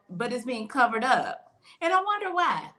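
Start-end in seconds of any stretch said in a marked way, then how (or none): 1.8-2.7 sarcastic. Real, not acted. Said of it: "I'm being real sarcastic".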